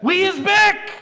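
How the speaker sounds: high loud we is back; shouted catchphrase. Pretend. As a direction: yell it like a hype line.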